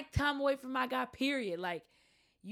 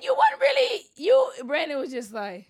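high, loud playful teasing; 6 semitones higher, 10 dB louder than usual. Real, not acted. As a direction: call him out playfully. Teasing friend loudly.

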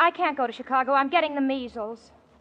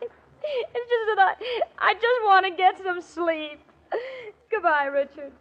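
tearful, breaking voice, slow and pitched up; crying; 4 semitones higher, slower than usual. Acted. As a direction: hold back tears and fail. Jane breaks down on phone.

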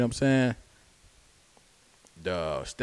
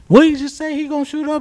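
high-pitched, alarmed retold question; scared manager; 7 dB louder, faster than usual. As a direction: imitate a slightly frightened coworker, pitched way up. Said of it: "she was kinda scared a little bit".

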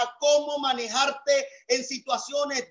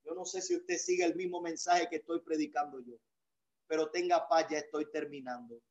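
quiet aside; 7 semitones lower, 10 dB quieter than usual. Real, not acted. Pastor reassures listeners he's finishing.

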